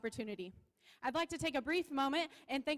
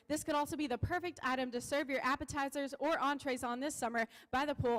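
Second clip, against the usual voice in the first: ordinary talk, nothing marked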